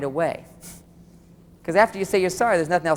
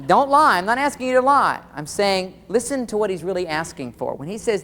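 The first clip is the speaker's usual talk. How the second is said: ordinary talk, nothing marked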